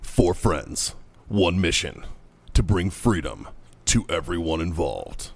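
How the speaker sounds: low, slow trailer narrator; deep epic announcer, drawn out; movie trailer voice. Pretend. Movie trailer parody intro.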